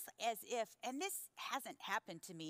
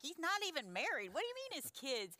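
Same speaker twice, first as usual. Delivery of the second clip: high-pitched retold protest; incredulous retort; 8 semitones higher than usual. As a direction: re-enact a baffled objection. Acts out her puzzled reply to God.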